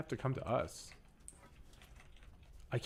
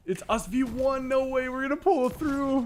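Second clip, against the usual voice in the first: excited; 6 dB louder than usual. Real, not acted. Last team fight, hyped.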